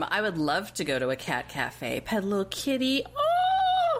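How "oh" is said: very high, adoring "oh"; high cooing squeal. Real, not acted. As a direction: coo over a little kitty. Gushing over petting a kitty.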